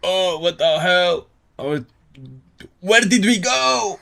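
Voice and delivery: high-pitched, drawn-out disbelief; what on earth just happened; real bewilderment. Real, not acted. Startled by sudden change in video.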